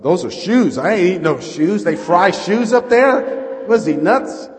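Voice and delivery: high, shouted comic outrage; loud disbelief. Retold or imitated. Preacher baffled by fried shoes, loud incredulity. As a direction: exclaim it as absolutely preposterous. Incredulous at eating hush puppies.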